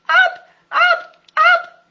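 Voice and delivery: high, loud shouts upward; shouting Piglet. A narrator's reading. Piglet at the bottom of the well shouting up to his friends. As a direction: yell with all his tiny might. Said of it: "shouted at the very top of his little voice".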